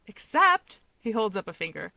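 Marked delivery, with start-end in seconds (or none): 0.1-0.8 eager objection. Acted. Keigo cuts in to argue.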